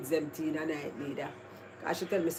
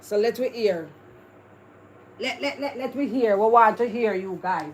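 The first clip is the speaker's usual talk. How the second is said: loud call; 4 semitones higher, 7 dB louder than usual. Real, not acted. Calling out to the viewers.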